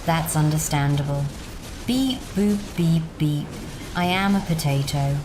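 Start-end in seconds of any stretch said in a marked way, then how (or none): none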